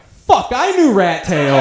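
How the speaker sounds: loud, high, slow cry of realization; shocked outburst. Acted. Son realizes he knew Rat Tail, acted loud outburst. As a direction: blurt it out in hammed-up dismay.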